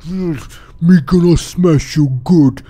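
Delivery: threatening ogre. Acted. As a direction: threaten like a dim brute.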